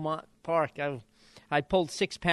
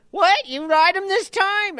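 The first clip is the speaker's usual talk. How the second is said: much higher and louder squeaky retort; sarcastic trainer; 10 dB louder than usual. Retold or imitated. Trainer mocking the jockey's request, much higher louder squeaky mimic. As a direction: fire it back in the chief's high voice.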